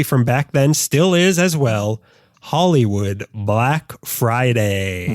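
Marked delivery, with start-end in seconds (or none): 2.4-5.1 slow, low announcer title; dramatic title read. Acted. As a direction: announce it like a movie trailer.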